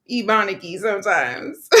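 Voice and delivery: laughing. Real, not acted. Laughing about her own speech.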